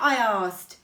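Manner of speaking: ordinary delivery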